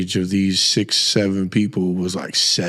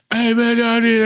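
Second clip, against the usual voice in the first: son calling from upstairs; 12 semitones higher than usual. Retold or imitated. Son yelling down to dad, excited high call. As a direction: call out excitedly from another floor. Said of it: "all I can hear through the vent is".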